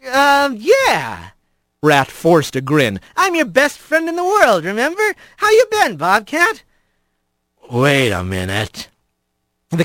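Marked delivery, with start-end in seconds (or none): 0.1-1.3 higher, awkward hesitant reply; nervous Rat voice. A narrator's reading. 3.1-6.6 much higher, fake friendly chirp; forced cheer. A narrator's reading. Rat fakes friendliness. 7.7-8.9 much lower, slurred, suspicious. A narrator's reading. Stoned Bobcat speaks.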